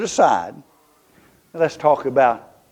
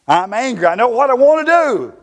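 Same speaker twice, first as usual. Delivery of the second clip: higher and louder, heated; angry Nehemiah; 9 semitones higher, 4 dB louder than usual. Acted. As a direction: let the anger rise up.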